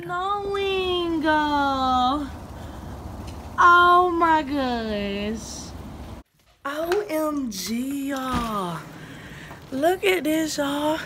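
high, loud thrilled exclamation; excited. Real, not acted. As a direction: gasp at the snow in delight. Sees snow falling outside.

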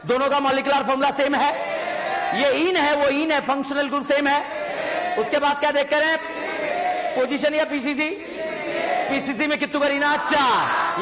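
high, chanted call-and-response; classroom drill call. Real, not acted. Teacher leading students to chain isomer, in a high chanting call.

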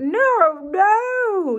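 high, loud yell; a mock yell of no no. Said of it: "yelled Gary".

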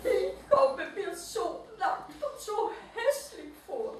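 high, teary little voice; mock sobbing mole-rat. A narrator's reading. Mole-rat sobs to the owl, high and teary. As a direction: sob it gently as the tiny creature. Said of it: "schluchzte der Nacktmull".

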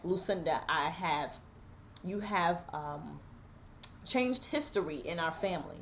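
soft, choked-up voice fighting tears; fragile, near-crying retelling; holding back tears. Real, not acted. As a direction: quietly swallow the tears while quoting mother. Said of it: "I'm trying not to cry".

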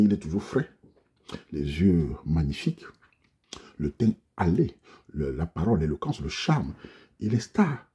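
low, soft, slow self-admiring voice; mock seductive self-praise. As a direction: purr out your own charms. Listing his own charms.

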